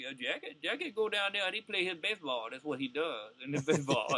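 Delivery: put-on old Southern ballplayer voice; Buck O'Neil. Comic imitating a ballplayer reminiscing about a teammate, Southern old-timer voice. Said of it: "you can't portray Buck O'Neil talking like Buck O'Neil".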